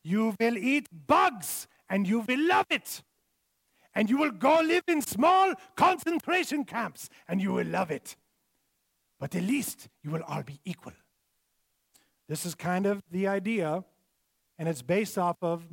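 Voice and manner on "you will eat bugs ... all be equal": mock German accent. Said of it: "he has a German accent".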